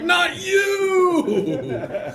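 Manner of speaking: high, loud, drawn-out comic holler; exasperated mock shout. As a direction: bellow the punchline in mock exasperation. Exasperated punchline of retold quarrel.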